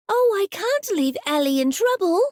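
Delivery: put-on high child's voice, worried but brave; a small girl talking herself into it; little girl to herself. Little girl reassuring herself about her doll, high and worried. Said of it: "the little girl told herself".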